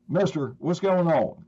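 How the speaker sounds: imitating a boy's Southern accent, pitched high; little Southern accent. Little boy comes up to frazzled responder, Southern accent. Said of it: "this little Southern accent".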